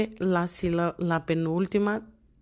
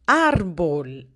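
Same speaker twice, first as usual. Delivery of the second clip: "Árbol" with a long, punched first syllable; teacher's demonstration of "Árbol", first syllable strongest; stress on ÁR; 2 semitones lower than usual. Shown as a demonstration. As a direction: lean hard on "Ár", let "bol" drop. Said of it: "la sílaba más fuerte es la penúltima".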